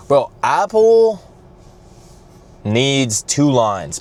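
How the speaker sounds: high, slow, drawn-out declaration; emphatic rant. Real, not acted. Complaining about Apple checkout lines, slow emphatic proclamation. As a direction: lay down the law to Apple. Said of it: "ranting about Apple queues".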